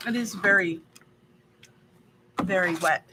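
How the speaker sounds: ordinary talk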